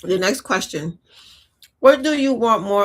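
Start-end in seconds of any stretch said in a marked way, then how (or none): 1.8-2.8 talking with a mouthful; mouth full. Real, not acted. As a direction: say it around a bite of food. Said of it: "We're talking with food in my mouth".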